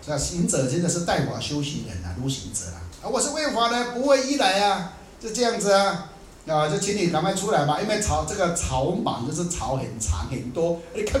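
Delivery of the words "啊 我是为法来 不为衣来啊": calling out. Retold or imitated. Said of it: "就喊啊".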